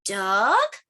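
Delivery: DUCK drawn out with a rising question tune. Acted. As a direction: ask "Duck?", sliding up at the end. Book guesses at each bird.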